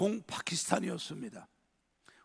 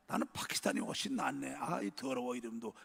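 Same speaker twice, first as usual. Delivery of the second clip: mock disgust; 6 semitones lower, 5 dB quieter than usual. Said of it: "그랬어요".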